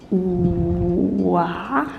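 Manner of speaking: "wa" stretched slowly from a long w into an open a; drawn-out "wa" sound. Shown as a demonstration. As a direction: demonstrate "wa", gliding slowly from oo to ah. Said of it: "which is the wa".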